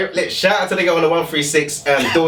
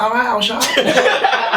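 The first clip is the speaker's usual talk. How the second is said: much higher, slow sheepish giving in; timid giving in; 9 semitones higher, slower than usual. Retold or imitated. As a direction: back down meekly with a laugh. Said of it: "I was like".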